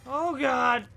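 loud scared gasp of words; freaked out by a jump scare; panicking gamer. Real, not acted. Scared by game event.

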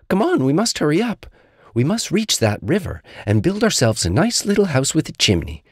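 high, urgent storybook tiger; high-pitched, excited little creature; eager Little Tiger. Acted. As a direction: hurry your friend along, high-voiced.

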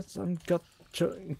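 ordinary talk, nothing marked

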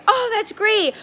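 high bright exclamation; upbeat oh great. Shown as a demonstration. Consultant hearing a friend used Scentsy, bright and high. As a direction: sound delighted by their answer. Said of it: "I say".

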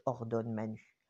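plain reading voice

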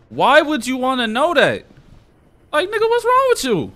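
hammed-up high disbelief; mock shrill voice. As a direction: squeal it like you can't believe it. Reacting to game's odd request.

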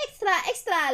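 high newsboy call; newsboy shout. Retold or imitated. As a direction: shout the headline. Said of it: "el niño gritaba".

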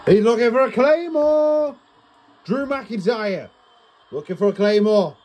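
watch-along viewer getting hyped; excited call. Real, not acted. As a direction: call it as the finisher nears. Big finish coming.

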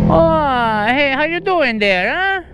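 put-on high, singsong Italian accent. Prankster answering the door to pizza delivery, mock Italian accent. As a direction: greet brightly in a put-on Italian lilt. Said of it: "uh, an Italian accent".

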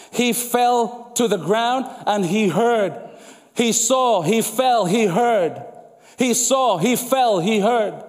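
slow, "saw", "fell", "heard" each punched and set apart; stress on each verb. Shown as a demonstration. Preacher spelling out the verbs to the church, each one punched. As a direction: hit every verb as a step. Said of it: "Notice the different verbs".

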